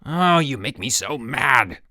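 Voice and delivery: slow, loud, strained outburst; angry scream. A narrator's reading. Harold fed up with Rudy, screaming angrily. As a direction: scream it out, dragging the words. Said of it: "screamed Harold".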